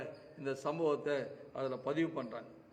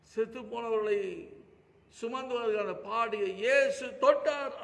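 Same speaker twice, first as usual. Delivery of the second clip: impassioned preaching; 8 semitones higher, 9 dB louder than usual. Acted. Preacher to congregation about the widow, full-force preaching. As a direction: lift your voice as a preacher crying out for the widow.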